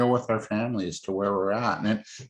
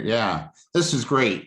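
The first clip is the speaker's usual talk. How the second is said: ordinary delivery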